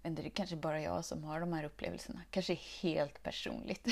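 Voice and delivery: ordinary delivery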